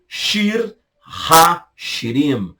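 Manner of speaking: "Shir HaShirim" very slow, with a breathed h; Hebrew pronunciation. Shown as a demonstration. Teacher presenting "Shir HaShirim" to students, slow and clear. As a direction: lay out "Shir HaShirim" slowly, word by word.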